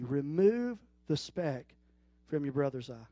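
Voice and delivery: very slow, "Remove" weighted, voice sinking by "eye"; stress on remove. Shown as a demonstration. Quoting the verse again to show the congregation what jumped out. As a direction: let "Remove" hang, then sink the rest. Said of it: "that jumped out at me".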